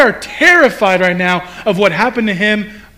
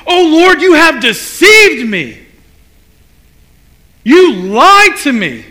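betrayed lament; 6 semitones higher, 6 dB louder than usual. Retold or imitated. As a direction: accuse God of deceiving. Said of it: "and he's like".

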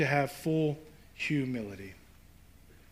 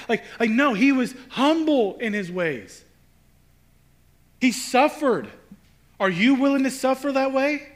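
fervent sermon delivery; preaching; 6 semitones higher, 6 dB louder than usual. Acted. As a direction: preach it with rising passion. Preaching at full force.

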